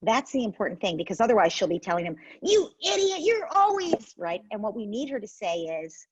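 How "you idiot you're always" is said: mock insult. Parent voicing insulting sister.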